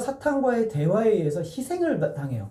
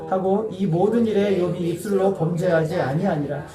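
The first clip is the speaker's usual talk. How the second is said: slow, solemn reading of scripture. Acted. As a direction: read the verse slowly and reverently. Congregation reads scripture aloud. (천천히 엄숙하게 성경 낭독)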